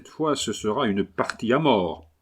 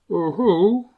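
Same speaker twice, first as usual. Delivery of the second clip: higher-pitched Englishman speaking broken French; English accent; 9 semitones higher, 4 dB louder than usual. Acted. Englishman haggling over his new dog, English accent. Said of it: "l'accent anglais".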